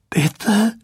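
a first, restrained plea, "Bitte," rising at the end; pleading. A narrator's reading. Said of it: "flehte Weasley mit brechender Stimme".

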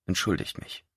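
soft, whispered apology; quiet murmur. Acted. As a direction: barely breathe the words as you slip away. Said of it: "murmelte ein leises".

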